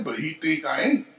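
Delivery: ordinary talk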